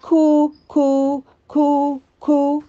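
high, slow, repeated coo; dove cooing. A narrator's reading. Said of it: "Then the dove spoke, saying".

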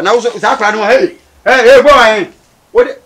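loud angry yell, pitch up; angry shout. Acted. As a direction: yell at the boy to stop. Yelling at the boy.

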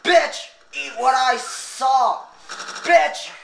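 angry trash talk. Pretend. Gamer insulting other players, angry shouting. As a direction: hurl each insult at full voice.

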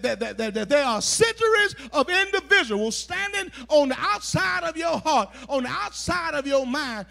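loud, driving sermon delivery; forceful preaching. Acted. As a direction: preach the list out with rising force.

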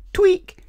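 loud squeaky tweak noise; high squeaky tweak. A narrator's reading. Sound effect in story.

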